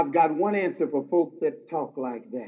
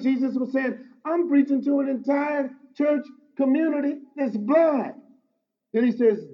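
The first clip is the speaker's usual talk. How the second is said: raised, ringing pulpit voice, building to a shout on the key lines; full-force preaching; 5 semitones higher, 3 dB louder than usual. Acted. Pastor exhorting his congregation, preaching at full force. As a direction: preach it hard and lift the room.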